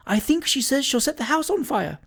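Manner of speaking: put-on high, fluttery old landlady; fussy landlady. A narrator's reading. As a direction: gasp it out, scandalised. Missus Tibbs voiced.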